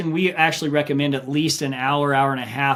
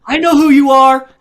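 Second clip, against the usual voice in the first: higher, louder frightened accusation; paranoid accusation; 9 semitones higher, 9 dB louder than usual. Retold or imitated. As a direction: accuse her like she's a witch. Hallucinating runner accusing wife.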